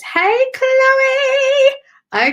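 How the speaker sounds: high, loud, stretched greeting; excited call-out. Real, not acted. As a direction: sing out the name in delighted welcome. Calling out greeting to arriving viewer.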